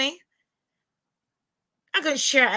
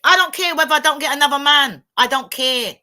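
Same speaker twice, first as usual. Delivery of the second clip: emphatic; 3 semitones higher, 7 dB louder than usual. Real, not acted. Emphatic declaration about leaving.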